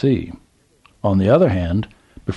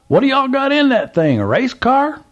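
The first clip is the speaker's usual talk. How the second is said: put-on old Southern gentleman; 4 dB louder than usual. A narrator's reading. Old man calls to trucker, high Southern voice. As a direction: play the curious old man at the gas pump.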